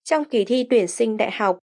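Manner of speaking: ordinary delivery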